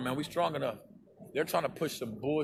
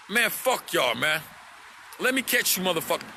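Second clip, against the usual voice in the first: angry; 3 semitones higher than usual. Real, not acted. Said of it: "Okay, let me calm down".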